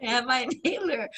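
words breaking up in laughter; real giggling speech; laughing. Real, not acted. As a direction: play along while cracking up. Teller laughs along with the bit.

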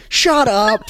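high, loud retort to a brother; snapping back. Retold or imitated. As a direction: fire it right back at him. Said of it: "And Kel's like".

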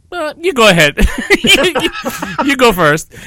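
high, loud laughing reply, pushing it back to him; laughing nervous dodge. Retold or imitated. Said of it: "I'm like".

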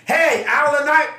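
high, loud, laughing call; mock greeting yell. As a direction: greet him like a stranger spotting a star. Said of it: "that they were like".

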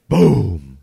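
loud, falling boom; imitated wall pounding. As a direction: boom it out like a fist hitting a wall.

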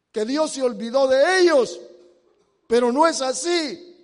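loud sermon delivery, building in force; rising, emphatic pulpit proclamation; full-force preaching. Real, not acted. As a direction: preach it out loud with punched key words.

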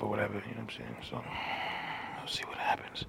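unvoiced, hushed aside; whisper. Real, not acted. Vlogger teasing viewers, whispered. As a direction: breathe it out as a secret. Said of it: "whispered aside".

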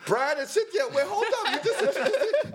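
high, laughing indignant shout; mock outrage. Realizing everyone got the same welcome, high laughing outrage. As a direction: yell it while laughing in disbelief. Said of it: "I was like".

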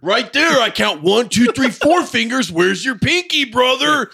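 very high, loud, hammed-up wrestler rant; Macho Man. Jealous wrestler picking apart the clip, hammed-up shouting. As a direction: count it off in mock outrage. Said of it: "And he'd be like".

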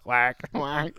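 quacking like a duck, playful; a silly duck quack. Retold or imitated. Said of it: "That's the best duck impression ever".

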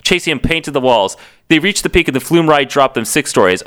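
normal talking voice

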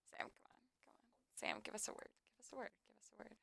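coaxing whisper. Real, not acted. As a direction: whisper to urge someone to speak. Coaxing silent guest into mic.

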